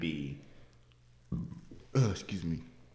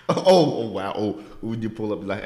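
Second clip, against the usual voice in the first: ordinary talk, nothing marked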